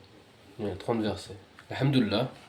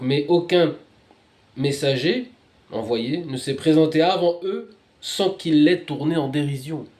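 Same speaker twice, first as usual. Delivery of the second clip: louder, phrase-by-phrase proclamation; declaimed scripture reading; 5 semitones higher, 8 dB louder than usual. Real, not acted. Reader telling of mocked messengers, loud declamation. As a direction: proclaim it with force, pausing between phrases.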